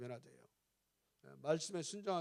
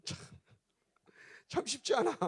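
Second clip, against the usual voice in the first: higher, laughing admission; rueful laughing; 9 semitones higher than usual. Real, not acted. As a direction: laugh through it at your own failing. Laughs at his own struggle.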